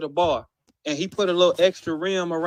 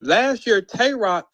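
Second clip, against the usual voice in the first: ordinary talk, nothing marked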